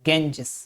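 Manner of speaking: गेंजिस said the American way, soft g; American pronunciation. Shown as a demonstration. Teacher demonstrating American गेंजिस. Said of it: "American में".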